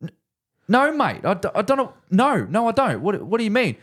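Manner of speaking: high, flustered stammer; confused mate. Retold or imitated. Mate confused by a strange request, high stammer. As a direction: stumble about, pitched high and baffled. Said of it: "Sam's like".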